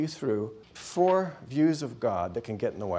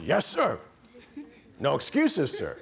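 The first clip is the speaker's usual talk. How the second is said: mock recruit's snappy reply, pitched up; obedient recruit; 4 semitones higher than usual. Recruit giving the proper response, dutifully raised voice. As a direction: answer the drill instructor like a recruit. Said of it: "proper response is".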